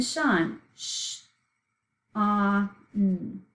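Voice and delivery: "shon" then hushed "Sh", open "a", hummed "n"; sounding it out. Shown as a demonstration. Teacher sounding out the last syllable, sound by sound. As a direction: split "shon" into its separate sounds. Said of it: "So let's sound it out".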